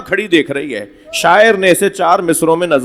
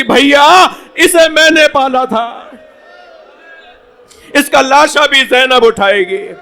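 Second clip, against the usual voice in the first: lamenting cry; 8 semitones higher than usual. Acted. Preacher voicing Zainab over her nephew's body, high wailing cry. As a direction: cry the scene out to the mourners. The climax of the mourning recital.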